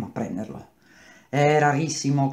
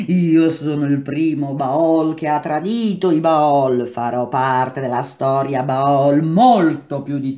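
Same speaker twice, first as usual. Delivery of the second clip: villain boast; 7 dB louder, slower than usual. A narrator's reading. Villain boasts after laughing.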